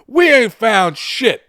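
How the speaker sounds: high, loud, drawn-out complaint; exasperated movie shout. Acted. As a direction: holler the punchline slowly. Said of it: "And the last guy says".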